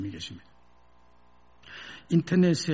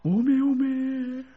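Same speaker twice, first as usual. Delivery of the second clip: mild tearful Jeolla cry; 9 semitones higher than usual. Acted. Son weeping all night, tearful Jeolla dialect. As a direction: wail in Jeolla dialect through tears. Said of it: "통곡하며 울었답니다".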